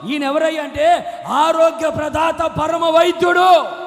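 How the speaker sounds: loud, high preacher's shout; full-force sermon cry; fiery preaching. Acted. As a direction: thunder it from the pulpit.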